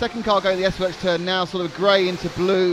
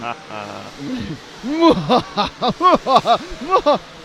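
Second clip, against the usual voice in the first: hammy mwahaha villain cackle; big theatrical villain laugh; evil laugh; 4 semitones higher, 4 dB louder than usual. Pretend. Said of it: "Can you do a mwahaha laugh?".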